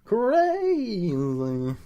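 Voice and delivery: high, long drawn-out exclamation; hammed-up crazy. Host echoing the crazy episode, hammed-up wail. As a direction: stretch the vowel way up high, then slide down. Hamming up co-host's word crazy.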